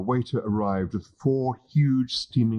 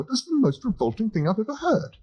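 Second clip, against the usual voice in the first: put-on higher, a disgusted young man; lightly voiced horrified Englishman; appalled Arthur. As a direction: recoil at the idea, pitched up.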